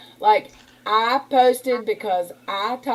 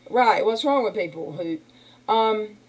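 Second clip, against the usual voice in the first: ordinary delivery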